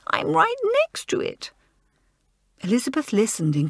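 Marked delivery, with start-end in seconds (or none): 0.1-1.5 much higher, thin and strained; squeaky voice. Acted. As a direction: let the voice crack upward in protest. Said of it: "her voice getting squeaky".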